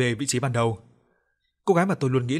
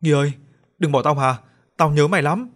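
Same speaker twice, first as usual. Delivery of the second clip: crying out loud; 3 semitones higher, 6 dB louder than usual. Acted. Narrator in a dream shouts after dead friend Nghi, desperate loud plea. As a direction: call out desperately after a friend who's slipping away. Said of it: "miệng vẫn gào to".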